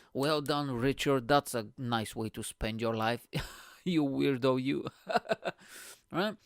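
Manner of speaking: ordinary talk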